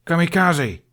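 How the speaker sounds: loud, sharp bark of a name. A narrator's reading. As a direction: snap the name out like a scolding mother. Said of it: "Bertha barked".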